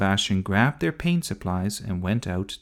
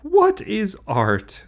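high, slow disbelieving echo; incredulous repeat; 12 semitones higher, 6 dB louder, much slower than usual. Acted. Big sister repeating brother's question, scornfully incredulous. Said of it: "repeated Sophie".

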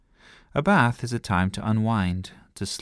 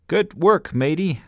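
high, loud hearty pirate; pirate captain praise; 9 semitones higher, 6 dB louder than usual. A narrator's reading. Girl captain praises her brother matey. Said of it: "she said".

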